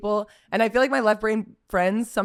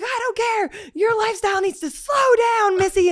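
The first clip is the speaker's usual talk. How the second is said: high scolding cop voice; high mock cop; 13 semitones higher than usual. Cop scolds friend, high mock lecture. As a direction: lecture her like a stern cop. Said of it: "he was like".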